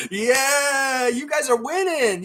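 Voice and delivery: high, loud gleeful taunt; laughing jeer. Real, not acted. Fan taunts opponents after their technical, high laughing jeer. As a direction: jeer through laughter in a squeal.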